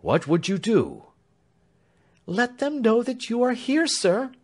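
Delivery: high, pleading woman's voice; frantic servant woman begging; agitated Clemency. A narrator's reading. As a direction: plead urgently, pitched well up.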